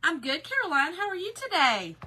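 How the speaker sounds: ordinary talk, nothing marked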